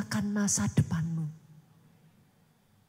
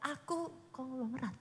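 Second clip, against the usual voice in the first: much softer, unsure Aku konglomerat; timid mumble; 12 dB quieter than usual. Shown as a demonstration. Preacher showing the weak, timid confession. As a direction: say Aku konglomerat barely audible. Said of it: "Jangan mulutnya ngomong".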